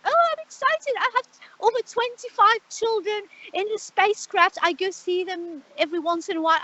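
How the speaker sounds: put-on high, gushing girly voice; imitating a giddy girl who loves the aliens; mock excited girl. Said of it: "she's like".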